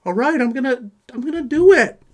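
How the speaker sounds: high, far-off mock whimper of a beaten kid; scared slapped kid. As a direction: squeak it out like a kid backing down from a fight. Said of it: "I've seen him get slapped like".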